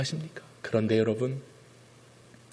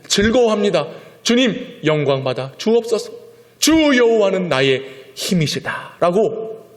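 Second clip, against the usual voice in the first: Habakkuk's confession; 2 semitones higher, 4 dB louder than usual. Acted. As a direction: confess then rejoice. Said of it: "하박국은 고백하는 겁니다".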